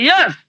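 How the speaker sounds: a clear, certain Yes; emphatic yes. Shown as a demonstration. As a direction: say Yes with full conviction. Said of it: "You should emphatically say".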